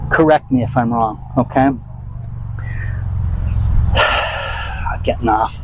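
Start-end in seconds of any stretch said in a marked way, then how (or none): 1.8-5.0 long, soft, breathy sigh; quiet drawn-out sigh. Real, not acted. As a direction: let out a long tired sigh. Long sigh after the rant.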